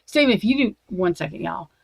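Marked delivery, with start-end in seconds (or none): 0.0-0.8 higher, louder sharp warning; angry warning. Real, not acted. Warning Steven to stop, sharp and raised. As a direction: cut him off with a threat.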